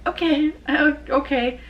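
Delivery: high, startled okay okay; bewildered okay. Retold or imitated. Viewer thrown into season three, bewildered and startled.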